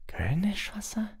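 high, slow, whispery question; baffled. A narrator's reading. Hermine hears what Hagrid is wearing, baffled. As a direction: echo the word high and drawn out in bafflement. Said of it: "sagte Hermine verdutzt".

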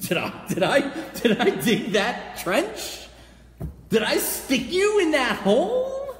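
high, loud, incredulous protest; exasperated God. Acted. God answering soldiers who blame Him for the trench, exasperated. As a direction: stretch each accusation out, slow and high. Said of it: "And God's like".

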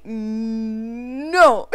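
drawn-out, loud "no" after a gasp; shocked refusal. Real, not acted. Gamer reacting to sudden game trouble, shocked refusal. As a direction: build a long, disbelieving no.

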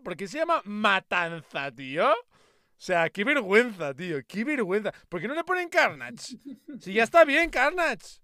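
queja incrédula que va subiendo; indignación. Real, not acted. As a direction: protesta cada vez más aguda, hasta casi gritar. Indignado por el doblaje de Carnage. (indignado de verdad, subiendo el tono)

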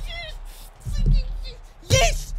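high, loud yell of triumph; jubilant shout. Real, not acted. Player celebrating the penalty decision, jubilant shout. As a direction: explode with a single celebratory cry.

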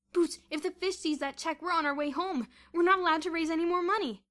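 high, loud protest; alarmed warning. A narrator's reading. Bruno warning Boots about the fish, loud alarm. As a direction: warn a friend urgently. Bruno warns of being caught.

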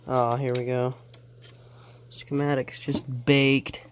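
normal talking voice

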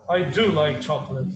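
"do" punched low and firm; rudely emphatic DO. Shown as a demonstration. Teacher showing how constant emphasis sounds rude. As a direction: overdo the stress on "do". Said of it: "like, rude".

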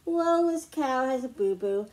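usual speaking voice

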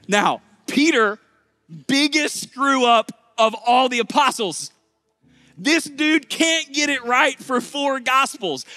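high, loud, emphatic preacher; full-force preaching. Acted.

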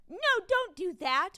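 high, loud protest; pleading with a misbehaving program; mock alarm. Retold talking to misbehaving software.

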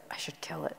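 low whispered decision. Retold or imitated.

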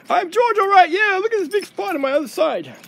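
high, giggly dog voice; chirpy dog George. Pretend. George the dog introduces himself on video, high and giggly.

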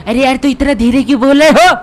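put-on loud high childish complaint; Shinchan voice. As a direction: yell it like a bratty cartoon kid.

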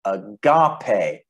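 "agape" with the middle syllable stressed, ah-GAH-pay. Shown as a demonstration. Teacher sounding out a Greek word, "agape" stressed on the middle syllable. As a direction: punch the GAH in "agape", slowly. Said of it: "with the emphasis in the middle".

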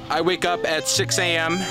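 ordinary talk, nothing marked

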